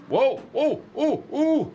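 high, rising alarmed whoa whoa; a rising chain of whoas, high and alarmed; startled whoas. Real, not acted. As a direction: grab the wheel as it slides away. Truck sliding downhill.

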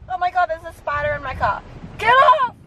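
panicked high shriek at a spider; screaming at a spider. Real, not acted. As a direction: yell at the spider in fright. Spider found in car.

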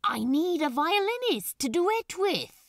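grinning, unrelenting. Acted. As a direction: grin as you insist. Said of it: "Crowley grinned, unrelenting".

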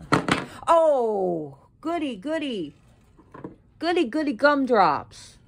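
loud, high-pitched mock cheering; sarcastic mock glee. Real, not acted. Vlogger who just busted something, sarcastic mock glee. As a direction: whoop it in exasperated, sarcastic delight.

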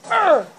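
short, loud high grunt; frustrated grunt. Real, not acted. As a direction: grunt hard as you fire. Grunt while shooting.

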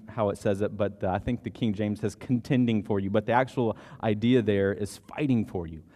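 usual speaking voice

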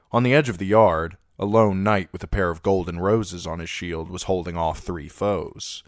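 plain reading voice

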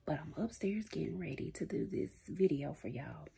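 sneaky whisper upstairs. Real, not acted. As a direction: whisper so she can't hear. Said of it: "I know I'm looking crazy".

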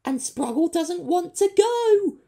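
very high, loud scared creature; nervous squeak. A narrator's reading. Creature scared of the planet, nervous squeak. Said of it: "squeaked a nervous Sproggle".

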